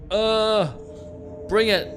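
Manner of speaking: high, loud bravado; psyching up. Acted. Player bracing for the dark basement, loud nervous bravado. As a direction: brace yourself with a loud, high challenge.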